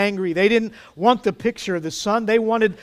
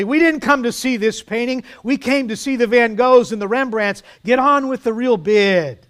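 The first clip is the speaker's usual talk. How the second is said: higher, louder irritated heckle; angry shout; 5 semitones higher, 5 dB louder than usual. A narrator's reading. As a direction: shout angrily at the auctioneer. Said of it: "Another voice shouted angrily".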